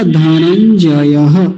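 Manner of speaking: धनंजयः slowly, ending in a breathed ha; visarga ending. Shown as a demonstration. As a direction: stretch dha-nan-ja-ya-ha, धनंजयः. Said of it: "विसर्ग भी है साथ में". (विसर्ग के साथ धीमा उच्चारण)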